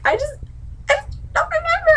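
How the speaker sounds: very high, squeaky mock whimper; whimpering mock excuse. Sansa claiming she forgot, mock squeaky whimper. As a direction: squeak it out helplessly, hammed-up.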